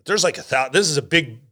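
ordinary delivery

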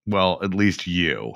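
ordinary talk

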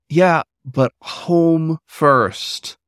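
high, very slow drawn-out yeah; flustered. Acted. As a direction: stall him, embarrassed. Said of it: "hiding the flustered embarrassment".

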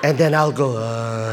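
mock-spooky grandma dropping low. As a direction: end on a low, drawn-out ghostly noise. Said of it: "Grandma, please don't talk".

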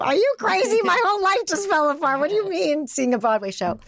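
replaying her own stunned inner reaction; incredulous. Retold thought refusing a friend's invitation.

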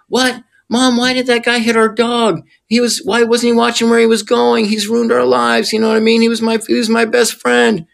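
put-on a kid crying out about his dead dog; upset little boy. Child voiced upset over dog.

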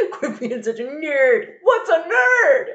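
high, slow, loud mock bafflement; bewildered dad. Dad confused by slang on the phone, bewildered.

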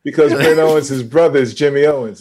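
loud, high, excited explaining. Real, not acted. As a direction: burst out with the news.

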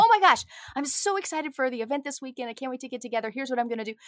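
high, loud gush easing as it goes; excited best-friend chatter. Retold or imitated. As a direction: gush to your best friend about weekend plans. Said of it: "Maybe it's excitement for something".

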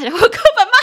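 fast, loud, rising into a laugh; amused question breaking into high laughter; bursting into laughter. Real, not acted. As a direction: start cracking up as you ask. Cracks up at own question.

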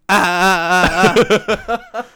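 loud high off-key squawks; imitated bird squawk. Friend mocking dancing birds, imitated squawking. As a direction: mimic a bird failing to sing along. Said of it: "they just go like".

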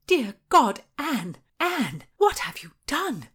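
high, anguished exclamation; shocked dismay. A narrator's reading. Margaret confronts Anne over her confession, horrified. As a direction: cry out in appalled disbelief.

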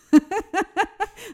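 high burst of laughter. Real, not acted. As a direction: laugh out loud, high and bright. Laughing at own story. (hohes Auflachen)

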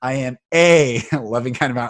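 "a" said as a long loud "ay"; stress on A. Shown as a demonstration. Teaching word emphasis to a podcast guest, stress on "a". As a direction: lean hard on "a", then hurry the rest. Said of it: "I teach people to emphasize words differently".

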